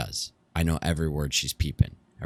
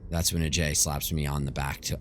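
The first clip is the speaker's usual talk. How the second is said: ordinary delivery, nothing marked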